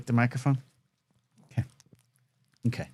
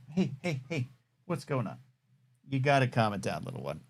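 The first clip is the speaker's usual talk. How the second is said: much softer, hushing a restless pet; soothing the dog; 2 semitones lower, 9 dB quieter than usual. Real, not acted. Host calming his chihuahua mid-show, soft soothing. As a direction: settle her down gently and quietly.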